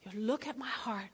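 soft whispered thanks to God; hushed grateful prayer in a whisper. Shown as a demonstration. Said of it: "get real quiet for a second".

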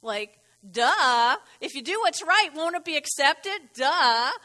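teasing loud duh; sarcastic duh. Acted. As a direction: make it plain as day, duh. Preacher mocks obvious answer.

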